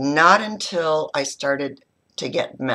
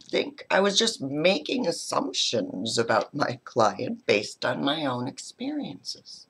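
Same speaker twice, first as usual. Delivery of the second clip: ordinary delivery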